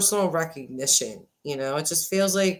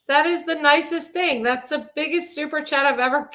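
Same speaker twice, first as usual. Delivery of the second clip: high-pitched, thrilled gushing, some words drawn out; excited; 10 semitones higher than usual. Real, not acted. Streamer thanking a viewer for a big super chat, overjoyed. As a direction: squeal with delight over a big gift.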